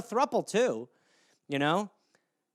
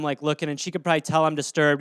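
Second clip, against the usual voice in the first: normal talking voice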